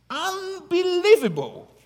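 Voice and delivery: unbelievable shot up high and loud; emphatic. Real, not acted. Preacher to congregation, unbelievable soaring high.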